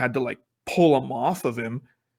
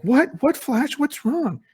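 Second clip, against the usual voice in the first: much higher, mock-bewildered voicing; puzzled friends. Friends greeting Barry, confused, in a much higher voice. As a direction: squeak it out like baffled friends.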